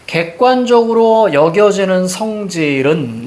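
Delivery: deliberate, drawn-out reading; slow model reading. Shown as a demonstration. Teacher showing students how to read the sentence, slow and deliberate. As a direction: read it out very slowly, stretching the phrase. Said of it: "이렇게 읽어야죠".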